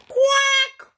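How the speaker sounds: very high, loud furious quack; outraged mother duck quack; enraged duck. A narrator's reading. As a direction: squawk in a storybook rage. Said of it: "flew into a rage".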